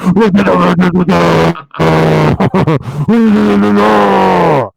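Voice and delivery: long loud growling scream; death metal scream. Retold or imitated. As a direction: let rip a metal scream. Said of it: "he'd play a death metal concert and go like".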